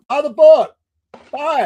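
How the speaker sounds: loud, high-pitched excited urging; exuberant, high call to buy; emphatic plug. Real, not acted. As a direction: punch it up like a hearty sales cheer. Host repeats plug, far more emphatically.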